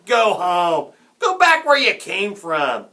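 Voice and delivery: loud, high jeering shout; heckling. A narrator's reading. Hecklers turn on Jack, jeering loudly. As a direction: heckle from the stands. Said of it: "turned into heckling and booing".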